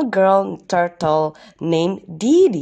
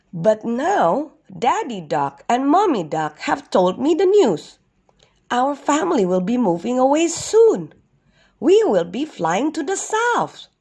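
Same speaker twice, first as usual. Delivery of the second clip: higher storybook voice for a duck; lightly voiced young duck; 6 semitones higher than usual. Duck tells turtle the family is moving, lightly voiced. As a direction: pitch it up, breaking the family's news.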